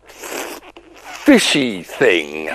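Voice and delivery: low, slow spell voice; deep magic words. Acted. Simon casting a spell, low and slow. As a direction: intone it slowly, low.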